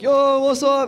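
high, loud pop-in greeting; excited greeting. Retold or imitated. Girl's crew walking into his house, excited greeting. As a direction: call it out brightly as you barge in.

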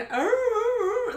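imitating a crying dog. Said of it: "like, crying".

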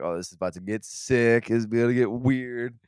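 building up the spooky moment; mock ominous excitement. Telling a friend at the psychic's table, mock eerie thrill. Anticipating the psychic getting strange.

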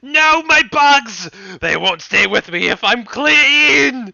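high, loud anguished villain; sputtering foiled villain. Acted. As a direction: wail as your bugs flee. Villain loses bugs.

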